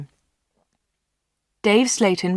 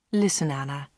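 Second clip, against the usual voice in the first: softer, lower murmured appeal; quiet; 4 semitones lower, 6 dB quieter than usual. A narrator's reading. Dave Slayton to Anna as he holds her, quiet. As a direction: say it softly, pitched down. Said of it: "he said quietly".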